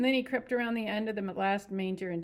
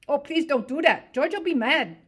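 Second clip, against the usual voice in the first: begging; 8 semitones higher, 13 dB louder than usual. Acted. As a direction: beg her to stop. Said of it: "he begged".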